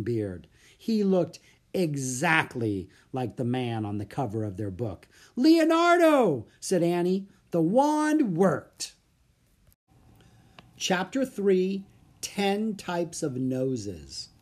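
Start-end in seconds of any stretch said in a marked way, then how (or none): 5.3-9.0 high slow wonder; amazed excitement. A narrator's reading. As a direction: gasp it out in awe. Annie amazed.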